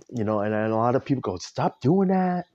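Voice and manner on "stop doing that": louder, higher, drawn-out telling-off; acted scolding. People urging the speaker to quit helping, scolding loudly. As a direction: scold them slowly in a raised voice.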